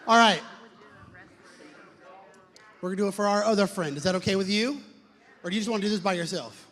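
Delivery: fast, loud hype-man talk; loud emcee patter. Acted. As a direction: keep the crowd fired up, quickly. Emcee keeps crowd pumped.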